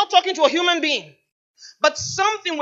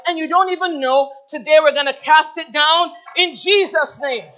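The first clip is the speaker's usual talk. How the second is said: loud, forceful preaching testimony; 3 semitones higher, 6 dB louder than usual. Acted. Preacher testifying to the congregation, loud and fervent. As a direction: proclaim it to the whole church. Builds to casting down.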